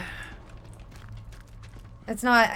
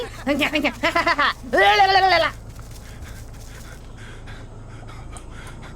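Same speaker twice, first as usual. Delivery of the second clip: 1 breathless cackling laugh; 3 semitones higher than usual. Real, not acted. Laughing at his old character.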